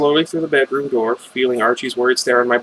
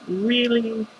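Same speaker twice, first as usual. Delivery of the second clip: skeptical aside; 10 semitones higher, 5 dB quieter than usual. Real, not acted.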